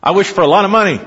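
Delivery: fast, high, greedy blurt; greedy wish. Retold or imitated.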